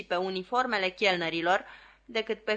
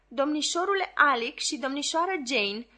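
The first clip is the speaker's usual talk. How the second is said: put-on nanny calling the children, voice pitched higher; 5 semitones higher than usual. A narrator's reading. Nanny shouting to two children in the garden, calling out. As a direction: call across the garden to the kids. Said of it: "striga una dintre dădace".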